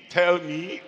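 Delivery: normal talking voice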